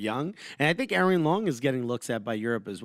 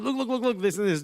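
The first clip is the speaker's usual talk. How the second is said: higher, urgent imitation of a fussing coach; anxious manager; 7 semitones higher than usual. Manager explaining tactics to his player, anxious and insistent. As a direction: press the repeated word with mock anxiety. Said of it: "Mister Anxiety".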